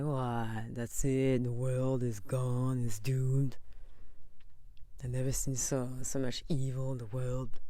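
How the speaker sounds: lightly played gloomy moaner; mock doomsayer. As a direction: sigh it out like the world is over. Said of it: "give up and be like".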